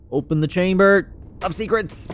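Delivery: mock magic command; grand command. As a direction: proclaim it like a spell. Calling out a game command.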